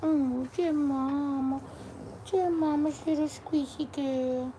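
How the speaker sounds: soft, slow cooing. Real, not acted. Mom coos softly and slowly to her dog.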